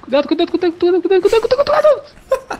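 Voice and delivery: rising high, laughing warnings; frantic warning. Real, not acted.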